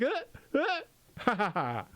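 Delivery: mock strangler grunts. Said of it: "it was going like".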